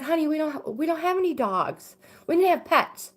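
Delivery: put-on high-pitched talk to a small child; imitated soothing mom. Mother to son woken from a nap, high coaxing voice. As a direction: gently reason with an upset toddler, replayed. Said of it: "I get him calmed down".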